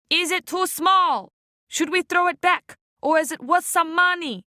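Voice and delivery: a lightly voiced rough fisherman; panting fisherman. Dripping man asks the others about his catch, rough and teasing. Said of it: "said the dripping man, panting".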